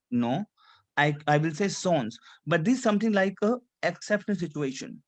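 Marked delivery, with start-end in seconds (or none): 1.7-2.2 "sons" with the last S sounded; final S pronounced. Shown as a demonstration. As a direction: end "sons" on a clear S. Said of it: "we have to pronounce S".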